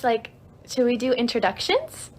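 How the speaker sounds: ordinary talk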